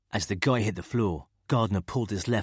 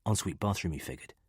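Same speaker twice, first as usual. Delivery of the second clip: ordinary delivery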